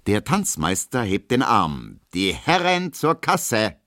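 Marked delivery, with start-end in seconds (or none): 2.1-3.8 dance master call. Acted. Dance master calls to pay.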